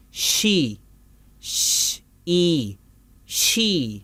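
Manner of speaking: شی split into SH and EE, then joined; blending sounds. Shown as a demonstration. Teacher blends two symbols for learners, sound by sound. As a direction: sound out شی as shhh, ee, shee. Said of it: "دونوں آوازوں کو ملا کے پڑھیں".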